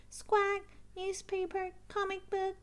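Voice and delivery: Polly parroting the shopping order, squawky. Acted. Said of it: "Polly repeats".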